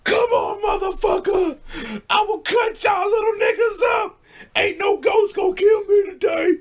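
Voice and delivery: high, loud, yelling threat with a knife; screaming threat. Retold or imitated. Drunk friend screaming at ghosts with knife, high yell. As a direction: shriek it like a man waving a pocket knife. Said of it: "Talk about".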